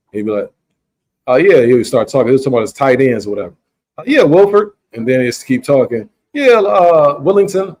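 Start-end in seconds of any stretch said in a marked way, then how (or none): 1.3-1.6 louder, offhand greeting impression; Jerry Jones. Team owner greeting a man he's met, louder impression. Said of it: "Jerry would be like this". 4.0-4.7 high, loud team-owner impression; Jerry Jones. Team owner getting a guest's name wrong, high loud impression. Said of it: "Jerry would be like this". 6.3-7.8 high-pitched team-owner impression; Jerry Jones. Team owner guessing at a guest's name, high impression. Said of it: "Jerry would be like this".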